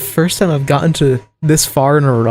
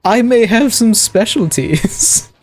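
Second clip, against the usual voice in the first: higher, slow, drawn-out coy admission; coy saboteur; 4 semitones higher, slower than usual. Acted. Ex-saboteur owning up to demolitions work, coy and drawn out. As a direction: play it drawn out and higher, hinting at a shady skill. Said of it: "they're like, "Oh".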